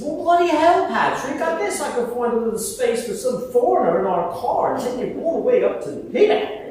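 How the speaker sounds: high, loud mock British accent, teasing. Wife teasing the foreign guest, loud British accent. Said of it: "this is where the British accent comes in".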